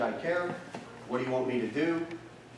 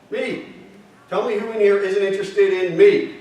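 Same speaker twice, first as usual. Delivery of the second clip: attention-grabbing call; 3 semitones higher, 7 dB louder than usual. Shown as a demonstration.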